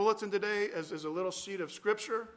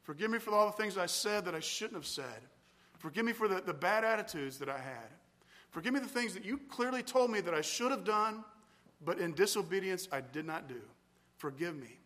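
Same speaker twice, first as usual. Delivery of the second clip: quiet, fading confession; modelling a prayer of confession; 2 semitones lower, 5 dB quieter than usual. Shown as a demonstration. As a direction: confess softly, letting it fade away. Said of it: "And I pour out here".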